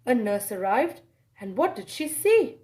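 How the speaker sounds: usual speaking voice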